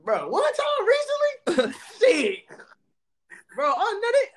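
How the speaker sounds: high, squeaky laughing talk. Real, not acted. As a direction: laugh through the words. Laughing while telling a funny story.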